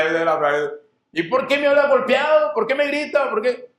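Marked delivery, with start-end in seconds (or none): 1.1-3.7 voz aguda de esposa ofendida que reclama; esposa quejosa. Acted. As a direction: reclama como mujer dolida, más agudo. Esposa quejándose del marido. (esposa reprochando, voz aguda)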